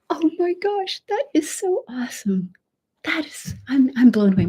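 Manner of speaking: real awe; amazed. Real, not acted. Host overwhelmed by viewers' support, amazed. Delighted reaction.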